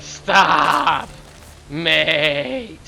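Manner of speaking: long dragged-out Australian "Stop, mate"; drawn-out mock Australian. Caster joking about Aussie speech, drawled mock Australian. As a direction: stretch each word in a bad Australian accent. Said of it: "You drag it out".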